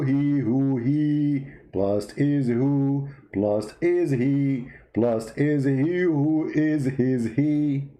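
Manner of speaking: slow, looping repetition; slowly going round in circles; slow dizzy repetition. Shown as a demonstration. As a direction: repeat it slowly like you're lost. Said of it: "I'm getting dizzy here".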